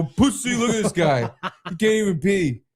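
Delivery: high laughing taunt; mocking call-out. Imagined doctor calling nurses over, jeering. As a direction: summon others to join the ridicule. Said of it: "calling all the nurses over".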